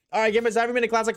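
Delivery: fast, high, loud sign-off to chat; hyped outro. Acted. Streamer hyping chat during the end-of-stream sign-off, fast and loud. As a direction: rattle off shoutouts like a hype host.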